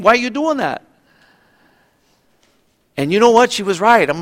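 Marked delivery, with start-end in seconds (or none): 0.0-0.8 put-on higher challenging girl. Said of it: "that's really what she was saying".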